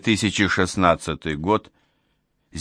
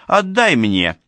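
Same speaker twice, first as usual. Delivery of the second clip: raised, higher-pitched indignant order; fussing demand; 5 semitones higher, 4 dB louder, slower than usual. A narrator's reading. Old woman demands the living stone, fussing and indignant. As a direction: demand it noisily, voice up. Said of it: "зашумела Никитишна".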